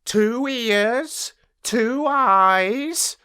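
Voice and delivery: a cocky little rat puffing himself up; proud little rat. Acted. Rat keeps boasting.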